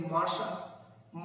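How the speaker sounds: मार साहब as a worn-down "master sahab", middle consonants lost; colloquial contraction. Shown as a demonstration. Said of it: "मास्टर साहब को सीधे".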